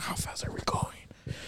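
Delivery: slow, barely voiced whisper; stunned whisper. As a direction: whisper it as if afraid to know. Retells his stunned question, whispered.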